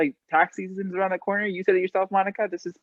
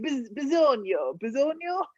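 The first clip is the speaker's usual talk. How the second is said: attempted Italian accent; 5 semitones higher, 4 dB quieter than usual. Shown as a demonstration. Guest trying the Italian word for need, attempted Italian accent. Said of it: "I'm gonna try it right now".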